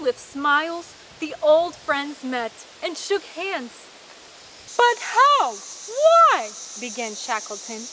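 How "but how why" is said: high, loud astonished questions; can't believe his eyes; amazed. A narrator's reading. Shackleton astonished to see Wild, high amazed cry.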